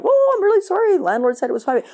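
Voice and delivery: startled tenant apologizing to an angry neighbor; startled apology. Retold or imitated. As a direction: blurt it out, caught off guard. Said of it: "And you're like".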